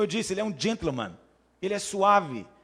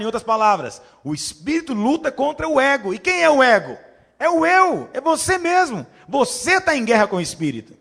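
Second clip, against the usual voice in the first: loud, higher full-force sermon; fiery preaching; 7 semitones higher, 9 dB louder than usual. Acted. Pastor preaching to the congregation about Jacob, fiery. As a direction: preach it with rising fire.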